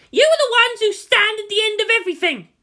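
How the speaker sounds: high, loud rallying cry to soldiers; shouted. Acted. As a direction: shout it to the whole parade ground. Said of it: "Mana Kirishima shouted".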